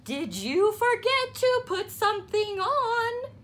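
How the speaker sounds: mother quizzing Froggy; mother asking. A narrator's reading. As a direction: ask with a knowing tease.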